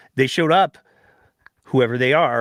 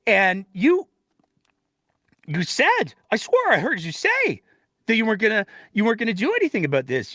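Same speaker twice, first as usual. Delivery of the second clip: high, pushy whoa-hold-on voice; mock alarmed objection; 7 semitones higher than usual. Voicing critics confronting the premier, high alarmed objection. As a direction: pump the brakes, pitched up and insistent. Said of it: "a lot of people said was".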